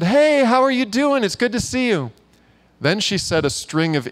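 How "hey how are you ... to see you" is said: cheery greeting. Retold or imitated. Greeting her at the door.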